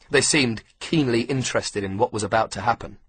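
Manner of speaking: usual speaking voice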